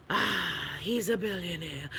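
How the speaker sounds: quiet, eerie, altered demon croak; imitating demon voice. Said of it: "Why the demon voice?".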